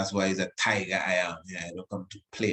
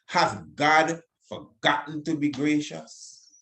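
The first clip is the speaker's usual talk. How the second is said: solemn scripture reading; 5 semitones higher than usual. Acted. Reading the psalm aloud.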